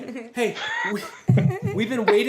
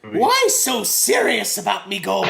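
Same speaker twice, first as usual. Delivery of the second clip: slow, growled Joker line in a leprechaun lilt; Joker-style leprechaun; 4 dB louder than usual. Pretend. Joking to cohosts, slow mock Joker-leprechaun voice. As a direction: drawl it like the Joker playing a leprechaun. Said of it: "That was pretty good".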